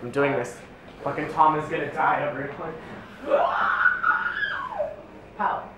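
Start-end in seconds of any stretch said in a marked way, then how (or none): 3.2-4.9 high shrieking cackle; shrieking laugh. Real, not acted. Shrieking laugh after threat.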